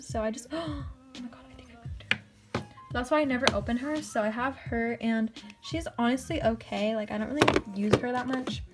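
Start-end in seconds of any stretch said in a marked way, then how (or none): 0.1-2.6 gasp, then a hushed, dismayed oh my god; startled gasp. Real, not acted. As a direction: caught off guard, dropping to a near whisper. The broken highlighter crumbles further.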